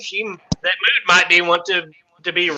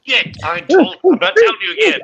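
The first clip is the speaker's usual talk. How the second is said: stern scolding; 11 semitones higher than usual. Pretend. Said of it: "get your ass back in the shadows".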